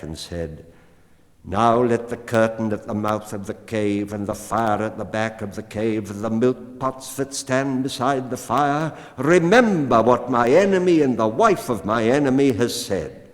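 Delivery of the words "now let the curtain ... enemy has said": louder, rising incantation; the cat, solemn vow. A narrator's reading. Cat calls on the cave to remember the promise, loud and solemn. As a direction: cast it like a spell over the cave.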